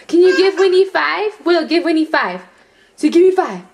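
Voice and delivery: high, loud excited coaxing; excited pet talk. Real, not acted. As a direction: squeal it at the dog. Excited coaxing of baby and dog.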